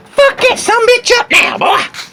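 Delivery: high squealing hype; excited high cry. Acted. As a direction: squeal with glee.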